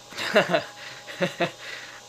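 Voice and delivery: breathy burst of laughter; real chuckling fit; amused laugh. Real, not acted. Amused by the game.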